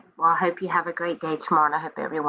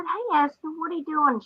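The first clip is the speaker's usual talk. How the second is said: sweet phone greeting; 11 semitones higher, 3 dB quieter than usual. Retold or imitated.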